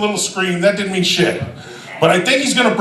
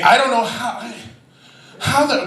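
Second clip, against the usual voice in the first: normal talking voice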